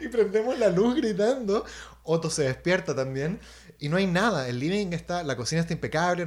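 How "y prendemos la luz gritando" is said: loud and laughing, a bit slow; laughing loudly through the line. Real, not acted. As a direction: still giggling as the story peaks.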